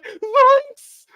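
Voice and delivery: very high cracking Shaggy impression. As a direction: squeak it like Shaggy. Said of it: "Like Shaggy".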